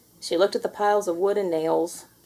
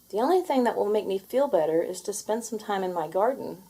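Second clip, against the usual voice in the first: ordinary delivery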